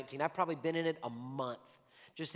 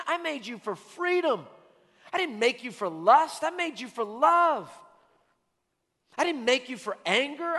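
rising, louder, impassioned divine voice; voice of God; 5 semitones higher, 4 dB louder than usual. Acted. God calling the believer out of the cave, impassioned plea. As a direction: build it up like a passionate calling. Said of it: "God says".

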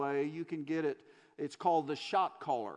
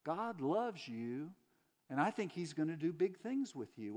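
put-on soft Southern accent; 4 dB quieter than usual. Church lady to a prisoner in his cell, soft Southern accent. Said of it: "say that same thing".

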